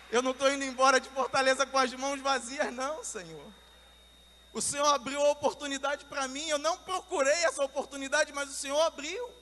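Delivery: loud, high, impassioned plea to God; fervent prayer. Retold or imitated. Preacher telling the Lord he won't leave empty-handed, fervent. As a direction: declare it boldly as re-enacted prayer.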